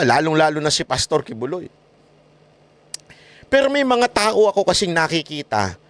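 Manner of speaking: usual speaking voice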